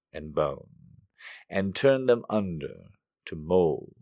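slow, loud, solemn recitation; dramatic poem reading. Acted. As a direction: read the poem with slow grandeur.

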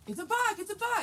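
put-on excited high, loud shout in a Spanish accent. Old Spanish guy alerts hunting partner, excited shout in Spanish accent. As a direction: mimic an old Spanish man yelling with his accent. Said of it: "he's got this accent".

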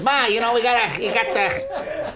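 high-pitched hammy mobster voice; Joe Pesci. Acted. Husband re-enacting Goodfellas to explain his joke, hammy Pesci impression. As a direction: badly imitate a movie gangster pestering his ma. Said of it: "Remember Goodfellas when he's sitting there".

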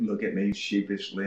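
ordinary talk, nothing marked

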